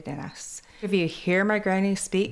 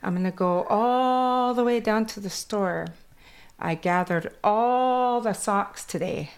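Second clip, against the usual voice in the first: put-on a light Kwakwala accent in English, a bit higher; 4 semitones higher than usual. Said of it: "my accent from Kwakwala goes into English".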